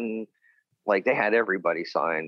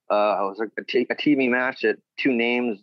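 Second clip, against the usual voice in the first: ordinary talk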